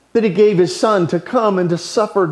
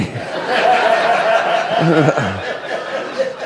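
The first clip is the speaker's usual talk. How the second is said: real laugh. Real, not acted. Teller laughs at his story.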